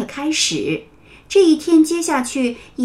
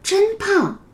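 tender praise; 9 semitones higher than usual. Acted. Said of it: "花儿老师摸了摸牛牛的头".

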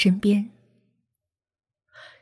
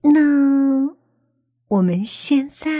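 coy seductive; 6 semitones higher, slower than usual. Acted. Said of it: "忽然媚笑着说道".

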